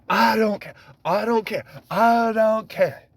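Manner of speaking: high, insistent mimicked indifference; mock dismissive quote. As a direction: brush it off high, mocking the politician. Mimicking Trump's answer about the election.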